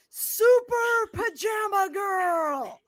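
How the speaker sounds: high, drawn-out superhero proclamation; big, stretched heroic reveal; superhero announcer. Acted. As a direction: call it out slowly like a hero's title.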